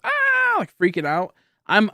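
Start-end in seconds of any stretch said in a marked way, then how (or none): 0.0-0.6 sudden high mock shriek; a short high "Ah!" imitating a frightened boss; retold scream. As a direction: shriek like the panicked manager. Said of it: "the manager there is screaming".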